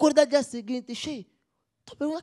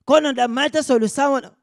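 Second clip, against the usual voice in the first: louder, fast and pushy; insistent loud question; 4 semitones higher, 8 dB louder, faster than usual. Acted. Father presses his son for a cure, loud and fast. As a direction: fire the question at him, impatient.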